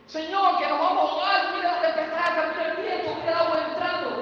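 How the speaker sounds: high, loud panicked begging; frantic plea. Acted. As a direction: shout it over the wind in terror. Disciples panic in storm.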